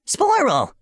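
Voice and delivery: high, squeaky "Sporal!"; little mushroom creature. Acted. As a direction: squeak your name like a tiny critter. Mushroom creature says its name.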